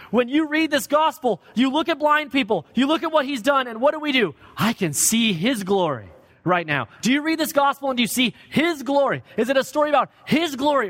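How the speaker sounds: preaching at full force. Real, not acted. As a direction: drive the question home. Preacher presses the congregation.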